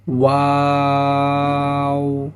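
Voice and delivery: ওয়াও sung long with rounded lips; long rounded sound. Shown as a demonstration. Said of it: "ওয়াও উচ্চারণের ক্ষেত্রে". (ওয়াও ঠোঁট গোল করে লম্বা টেনে)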